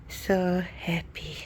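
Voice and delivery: breathy whisper of joy. Real, not acted. Telling viewers she is happy, whispering. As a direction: whisper it, overcome with happiness. Whispered after excited reveal.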